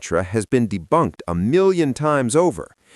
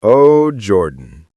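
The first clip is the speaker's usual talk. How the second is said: sighing address; 8 dB louder, much slower than usual. Real, not acted. Replying creator addresses Jordan.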